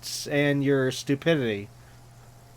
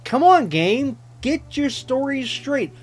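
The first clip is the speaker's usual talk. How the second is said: exasperated; 5 semitones higher than usual. Real, not acted. Exasperated complaint at the game.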